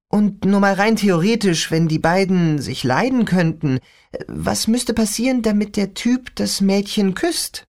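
put-on a young girl griping about her father; teen girl voice. Rachel teasing question.

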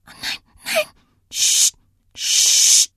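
whispered dread, then forceful shushing; frightened whisper. A narrator's reading. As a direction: whisper in fear, then hiss for silence. Terrified child on emergency line hushes. (ängstlich geflüstert, dann scharfes Zischen)

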